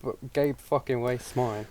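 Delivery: ordinary talk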